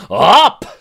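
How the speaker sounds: loud, high roared command. Acted. Scot setting the challenge: loud roar. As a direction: bark it out like a war cry. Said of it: "she roars".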